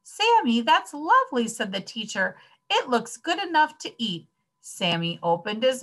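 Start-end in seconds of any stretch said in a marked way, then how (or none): 0.0-1.5 high, loud gushing; delighted teacher praise. A narrator's reading. Teacher praises child's painting. 2.7-4.3 high-pitched admiring teacher; delighted praise. A narrator's reading. Teacher praises child's painting.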